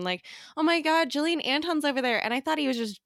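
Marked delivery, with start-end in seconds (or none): 0.5-2.2 high-pitched teasing fake thrill; mocking excitement. Friend teasing her at a play, high mock excitement. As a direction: imitate a friend pretending to be starstruck. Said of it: "making fun of the situation".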